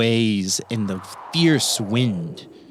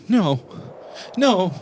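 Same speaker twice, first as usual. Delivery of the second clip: put-on little boy refusing; 8 semitones higher than usual. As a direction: refuse like a scared little kid, high-pitched. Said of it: "You see the little boy go".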